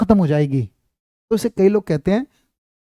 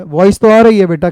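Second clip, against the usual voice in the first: exasperated loud retort; 10 dB louder than usual. Real, not acted. Student says no voice.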